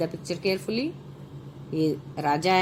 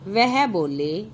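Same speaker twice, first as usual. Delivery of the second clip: put-on slow, clear reading for children; story narration; 3 semitones higher, 3 dB louder, slower than usual. A narrator's reading. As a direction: read each phrase out slowly to the class. Reads the story aloud. (बच्चों के लिए कहानी पढ़ना)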